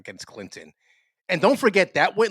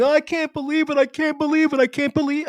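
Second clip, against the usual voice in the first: imitating a wailing neighbor, pitched much higher; hammed-up blubbering over the election; mock sobbing; 13 semitones higher, 4 dB louder than usual. Mimicking the downstairs neighbor, mock sobbing.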